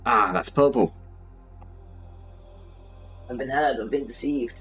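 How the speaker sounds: ordinary delivery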